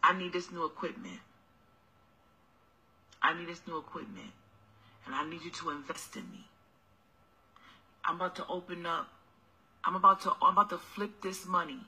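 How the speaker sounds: low, soft sweet-talking hustler voice; mock broke boyfriend. Mocking a broke boyfriend asking.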